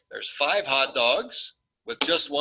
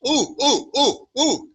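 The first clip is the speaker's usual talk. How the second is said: put-on high, excited ooh-ooh of a kid dying to answer; eager student; 13 semitones higher, 5 dB louder than usual. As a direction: squeal like a student waving a hand.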